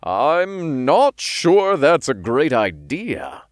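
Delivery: slow, reluctant disagreement; protesting. A narrator's reading. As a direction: drag out a doubtful objection. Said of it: "Raphael protested".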